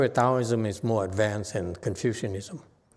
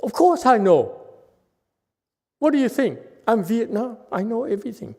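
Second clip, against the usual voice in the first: much higher, cocky know-it-all voice; mock proud disciple, higher and louder; imitating a Vietnamese student; 10 semitones higher, 4 dB louder than usual. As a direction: play the boastful student, pitched way up. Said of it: "Vietnamese say".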